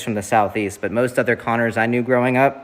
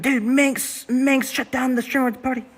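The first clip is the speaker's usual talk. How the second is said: very high mock chatter voice; Lil Krish; 13 semitones higher than usual. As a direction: imitate an informant in a squeaky put-on voice. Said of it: "Did I, did he, like".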